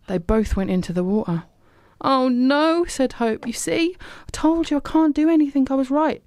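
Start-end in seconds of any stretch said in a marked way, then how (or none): 2.0-6.2 much higher, distressed and despairing; upset wail. Acted. Hope after falling in the water, high distressed wail. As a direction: cry out in dismay after slipping.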